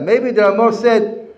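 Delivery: ordinary talk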